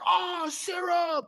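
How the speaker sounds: very high, drawn-out, shouted gush; hammed-up delight. Founder handed a serum, slow high delighted shout. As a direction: stretch it out like a kid on a birthday.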